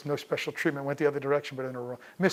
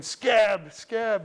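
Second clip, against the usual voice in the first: high-pitched, raised imitation of a picket-line scream; retold scream; 7 semitones higher, 6 dB louder than usual. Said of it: "I start screaming".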